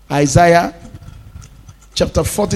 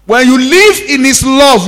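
higher, louder preached line; fervent preaching; 6 semitones higher, 5 dB louder than usual. Acted. Pastor promising glory to listeners, fervent. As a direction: lift it with conviction.

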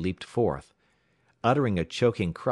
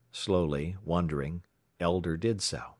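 usual speaking voice

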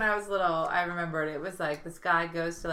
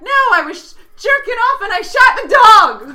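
high, loud panicked punchline; frantic kid; 14 semitones higher, 17 dB louder than usual. Acted. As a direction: yell the confession as a distraught boy. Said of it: "Third kid comes in".

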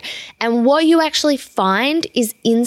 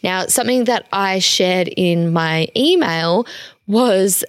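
ordinary talk

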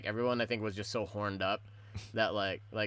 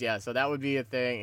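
normal talking voice

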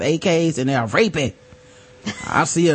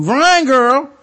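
mock warning; 8 semitones higher, 5 dB louder than usual. Warning a friend about men.

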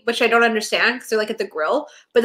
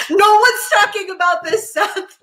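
laughing mock scold; 9 semitones higher, 3 dB louder than usual. Scolding Seth in mock quote.

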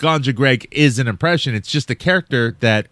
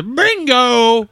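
clown catchphrase; 10 semitones higher, 4 dB louder than usual. Retold or imitated. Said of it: "he goes".

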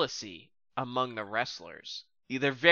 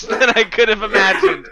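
laughing, high and loud; 9 semitones higher, 7 dB louder than usual. Real, not acted. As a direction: laugh through the words. Laughing at the entrance.

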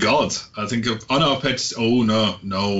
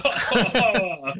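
laughing; 9 semitones higher than usual. Real, not acted. Laughs after vampire impression.

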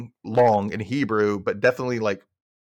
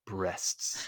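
muttered; 4 semitones lower, 12 dB quieter than usual. Acted. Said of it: "he starts to say".

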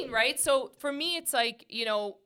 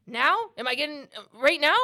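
louder, incredulous question; retold impatient disbelief; 5 semitones higher, 9 dB louder than usual. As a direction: burst out with eager disbelief.

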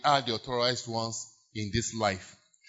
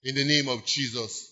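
ordinary delivery

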